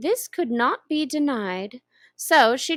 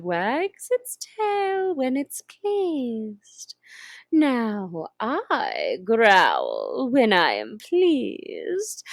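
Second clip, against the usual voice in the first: slow, purring Cheshire Cat voice; slow drawling cat; 3 semitones higher, 4 dB louder, slower than usual. Acted. Grinning cat teasing the lost girl, slow and drawn out. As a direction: stretch each word like a lazy cat.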